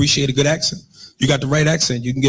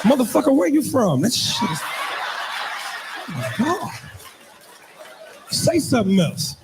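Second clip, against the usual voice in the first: high-pitched, smitten mock excitement; swooning woman; 7 semitones higher, 3 dB quieter, much slower than usual. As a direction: gush as if thrilled by the accent. Woman swooning over the English accent.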